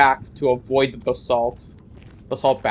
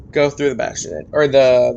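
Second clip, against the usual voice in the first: normal talking voice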